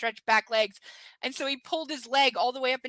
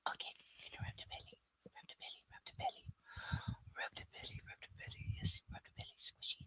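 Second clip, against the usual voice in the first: whisper; 15 dB quieter, faster than usual. Real, not acted. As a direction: whisper sweet nothings to the dog, very soft. Whispering to the dog, petting.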